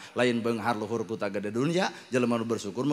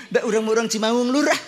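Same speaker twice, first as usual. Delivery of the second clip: laughing; 10 semitones higher, 6 dB louder than usual. Real, not acted. Laughing punchline after the quote.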